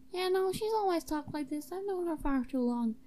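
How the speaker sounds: lightly put-on high voice; high-pitched sidekick; high-voiced sidekick. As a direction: vouch for her in a high character voice. Voices a podcast character.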